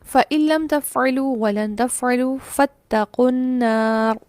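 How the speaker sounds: slow chanted tajweed; Quran recitation. Shown as a demonstration. Teacher recites the next example verse, slow tilawat. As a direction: recite the verse slowly and melodically. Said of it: "next मिसाल देखिए".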